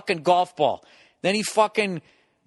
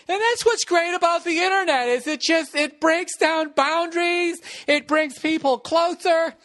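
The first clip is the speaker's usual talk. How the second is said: mock-sincere moral; 11 semitones higher than usual. Comic wrapping up the caning story, high mock-earnest voice. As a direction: put on a high voice for a sappy moral.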